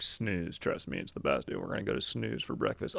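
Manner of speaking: deep and sure of himself; imitating a deep voice. Friend insists on Snooze for breakfast, deep imitated voice. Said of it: "He's got a deep voice".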